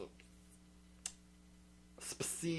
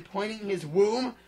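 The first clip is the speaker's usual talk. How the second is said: normal talking voice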